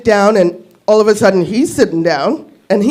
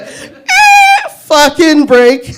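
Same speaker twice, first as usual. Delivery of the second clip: exasperated yelp; 6 semitones higher, 5 dB louder, much slower than usual. Retold or imitated. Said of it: "I was like".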